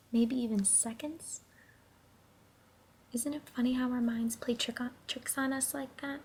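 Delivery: ordinary delivery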